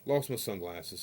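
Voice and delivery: ordinary talk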